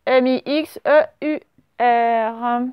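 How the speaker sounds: ordinary delivery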